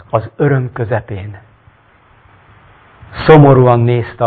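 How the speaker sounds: dramatic reading of a Bible-like tale; story narration. A narrator's reading. Reads the story aloud.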